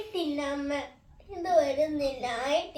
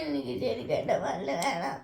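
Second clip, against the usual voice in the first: softer, crying plea; tearful plea; 6 semitones lower, 9 dB quieter than usual. Real, not acted. Girl pleading with mother to keep her tooth, crying softly. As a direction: plead through quiet tears.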